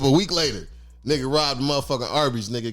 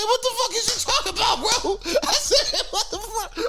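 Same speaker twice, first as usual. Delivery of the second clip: high squeaky laughter through the words, cracking up; retelling the robbery request, laughing in disbelief at the friend. Retold or imitated. Incredulous laughing question.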